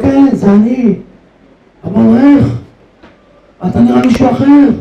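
high, loud astonished cry; shocked exclamation. Acted. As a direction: gasp out the disbelief. הרב נדהם מהמראה. (קריאת תדהמה רמה)

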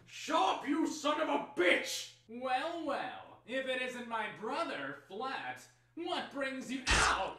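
a brawl heard far in the distance; distant scuffle. Acted. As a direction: shout from another room. Fight heard from far away.

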